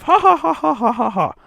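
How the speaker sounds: high, forced "ha ha" laugh; mock guffaw, each ha separate; fake audience laughter. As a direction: imitate a crowd cracking up, hammed up. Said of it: "everybody will fucking laugh".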